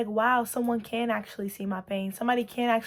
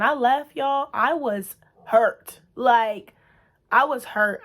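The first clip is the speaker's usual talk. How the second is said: pained outburst; 3 semitones higher, 8 dB louder, much slower than usual. Real, not acted. Recalling the pain of leaving.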